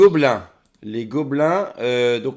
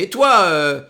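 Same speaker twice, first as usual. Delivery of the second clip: higher, louder commanding villain; high bossy NPC voice; 6 semitones higher, 4 dB louder than usual. Acted. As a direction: order the lackey around, high and loud. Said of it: "Et elle fait".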